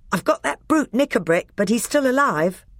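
put-on high, effortful boy's voice; panting. A narrator's reading. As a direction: report it as if winded from a fight. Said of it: "panted Edmund".

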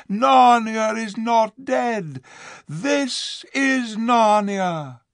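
proclaiming eagle. A narrator's reading. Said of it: "he cried".

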